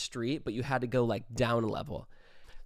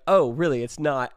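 sarcastic; 3 semitones higher, 9 dB louder than usual. Retold or imitated. Manager showing another photo, loud sarcastic disbelief. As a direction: catch them out with sarcasm.

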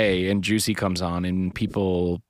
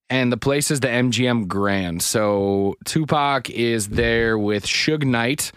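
usual speaking voice

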